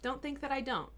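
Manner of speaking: ordinary talk, nothing marked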